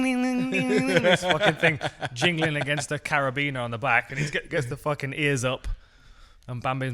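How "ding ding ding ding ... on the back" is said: high ringing ding ding; mock bell dings, laughing through; jingling bells. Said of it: "all the deer can hear in the background is ding".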